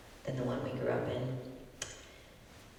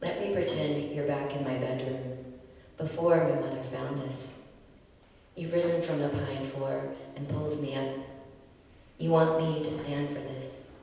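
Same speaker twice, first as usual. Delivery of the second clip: louder, earnest spoken-word delivery; open mic poet; 5 dB louder than usual. Acted. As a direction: perform it like an open mic set. Poet performs poem after intro.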